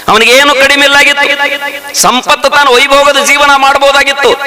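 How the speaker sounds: high, ringing sermon voice; fervent village preacher; preaching at full force. Acted. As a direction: preach it out to the crowd.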